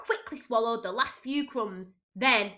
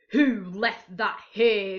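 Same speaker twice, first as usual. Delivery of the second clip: slow, louder pirate growl; growling captain; 7 dB louder, slower than usual. A narrator's reading. Wet captain demands who left the bucket, slow louder growl. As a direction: growl it out, annoyed. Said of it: "growled the captain".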